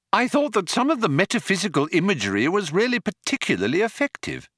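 high, quick gushing critic; eager Arthur. A narrator's reading. Arthur flattering the Vogon poet, eagerly bright.

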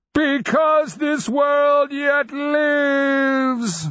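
huge, high, drawn-out roar; roaring beast. A narrator's reading. Undead dragon answering the Nonman, roaring. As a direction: roar every word long, high and loud. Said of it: "the undead beast roared".

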